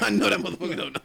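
amused, talking through real laughter; laughing. Real, not acted. Host teasing his co-host over what he doesn't know, laughing. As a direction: say it while cracking up.

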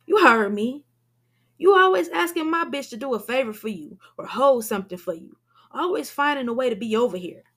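loud, fast hostile accusation; 1 angry accusation. A narrator's reading. Shakim accusing Damien over his girl, loud and angry. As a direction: pick a fight with him.

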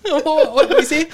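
high, giggling through words; laughing. Real, not acted. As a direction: laugh while you say it. Reacting to joke, laughing.

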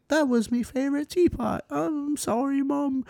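high mock radio voice apologizing to Mom. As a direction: squeak it out like a voice on the radio. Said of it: "she hears the radio be like".

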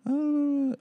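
high, loud, frantic shrieking mimic of the wife; mock her shrill panicked yelling on repeat; a woman yelling the same thing over and over; panicked yelling nurse. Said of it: "fucking yelling as loud as possible".

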